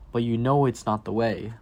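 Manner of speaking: ordinary delivery, nothing marked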